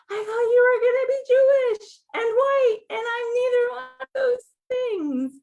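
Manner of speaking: high, loud hammed-up gasp and wail; mock gasping caller. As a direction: play the shocked caller big. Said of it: "people say".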